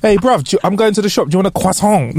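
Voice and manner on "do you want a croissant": croissant in a mock French accent, as "kwa-sɔ̃". Shown as a demonstration. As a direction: say croissant the French way, nasal end. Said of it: "No, don't do that to me".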